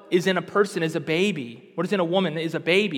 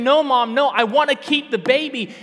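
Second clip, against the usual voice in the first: tearful pleading; 6 semitones higher, 6 dB louder than usual. Retold or imitated. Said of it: "The girl was weeping and crying and saying".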